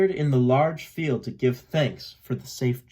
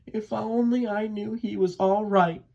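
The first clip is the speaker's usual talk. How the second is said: usual speaking voice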